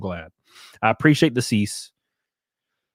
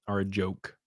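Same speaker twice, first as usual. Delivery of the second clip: ordinary talk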